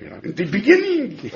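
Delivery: a light hint of the old European accent; funny European accent. Explaining the European accent to a study partner, lightly mocking. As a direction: tease the old-country accent that comes from transliterating. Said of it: "funny, uh, accent from in, uh, in, in Europe".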